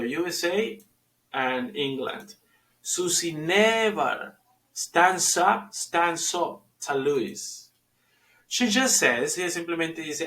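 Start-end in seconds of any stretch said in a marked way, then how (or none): 2.8-7.7 Susie never stands up, said slowly and deliberately; slow it down and lean on "never"; slow model sentence. Shown as a demonstration. Teacher modeling "Susie never stands up" for Spanish-speaking learners, slow and clear.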